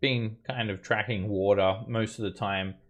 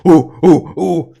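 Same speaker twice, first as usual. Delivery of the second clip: loud high ape hoots; aggressive ape ooh; 9 semitones higher, 20 dB louder, slower than usual. Acted. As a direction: hoot like an angry ape. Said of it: "get increasingly aggressive now".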